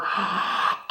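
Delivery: sharp soft intake of breath; shocked gasp. Real, not acted. Finding a book she never knew, shocked gasp. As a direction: gasp at the news.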